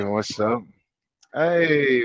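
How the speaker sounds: ordinary talk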